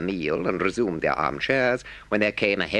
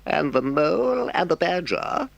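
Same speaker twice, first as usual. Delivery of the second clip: high, light offhand Toad voice; breezy, careless toad; Toad, lightly; 13 semitones higher than usual. A narrator's reading. Toad asks Rat offhandedly about his friends, lightly. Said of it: "said Toad lightly".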